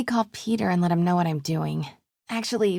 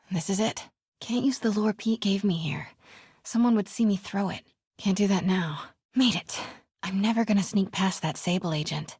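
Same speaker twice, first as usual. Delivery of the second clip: ordinary delivery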